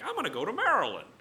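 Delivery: high, loud, quick mock chirp of a plan; breezy mock announcement. Imitating a casual traveler, bright and quick. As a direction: toss it off like a short hop down the road. Mock trip that's no big deal.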